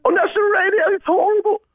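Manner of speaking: shrieking, giggly insult in a falsetto; outraged English comic in a high squeal; Ricky Gervais impression. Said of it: "It's Ricky Gervais insulting would be".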